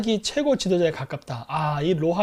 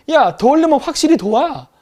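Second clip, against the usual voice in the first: higher, louder fed-up outburst; angry rant; 4 semitones higher, 5 dB louder than usual. Retold or imitated. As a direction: snap at the ally who keeps half helping. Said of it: "이렇게 나가는 거예요". (높고 큰 소리로 열받은 듯 따지기)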